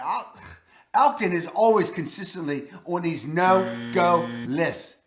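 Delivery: ordinary talk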